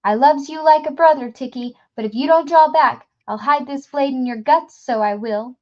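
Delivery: loud, high threat through panting; desperate threat. A narrator's reading. Gasher threatening TikTok with a blade, loud and desperate. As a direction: warn him off, scared and fierce.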